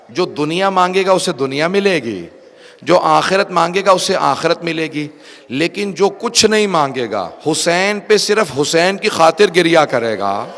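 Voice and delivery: impassioned majlis preaching; full-force preaching. Acted. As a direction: drive the point home to the gathering.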